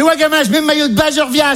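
high-pitched mum impression; imitated mother. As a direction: answer as the high-voiced mother. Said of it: "Elle fait". (voix aiguë de la mère imitée)

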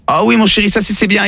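ordinary delivery

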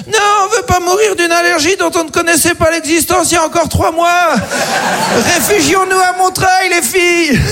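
high-pitched mock panic of fleeing mums; mothers crying out. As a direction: cry out as a hammed-up panicked mother. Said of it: "qui se barrent en criant".

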